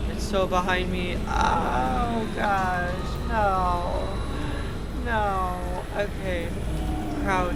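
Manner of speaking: loud panicked cries; frantic, breathless urging to hide; being chased. Real, not acted. Monster right behind her.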